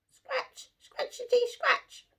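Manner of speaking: high squeaky scratching sound at the door; scratching noise. Acted. Porcupine scratches at Jim's door, high squeaky scratch sounds. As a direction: make the tiny scratching noise, high and light.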